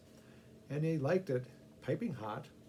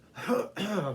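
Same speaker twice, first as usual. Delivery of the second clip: throat clearing; 6 semitones higher, 5 dB louder than usual. Acted. Man coughing from pepper sauce.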